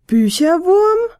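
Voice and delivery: hoch, langsam und lauter erstaunt gefragt; ungläubiges Staunen. A narrator's reading. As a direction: gedehnt und fassungslos nachfragen. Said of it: "fragten die beiden ... wie aus einem Mund". (erstaunt und gedehnt)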